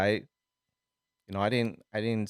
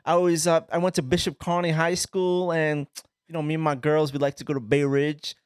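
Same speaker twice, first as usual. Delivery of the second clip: louder, higher imitation of a Brooklyn girl; Brooklyn accent; 6 semitones higher, 4 dB louder than usual. Girl from Brooklyn telling him about her high school, thick Brooklyn accent. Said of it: "But her Brooklyn accent, it was just like".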